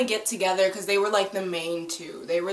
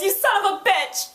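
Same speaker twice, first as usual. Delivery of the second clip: mock angry yell; 12 semitones higher, 4 dB louder than usual. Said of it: "and she goes".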